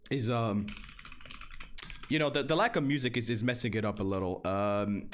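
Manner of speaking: normal talking voice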